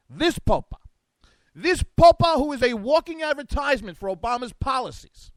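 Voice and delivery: a comic Italian lilt on "popa"; mock Italian accent. Host telling the pope story in a hammed-up Italian accent. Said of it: "I don't know why I'm doing this in Italian".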